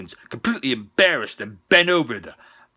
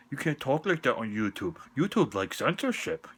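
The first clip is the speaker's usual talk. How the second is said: ordinary talk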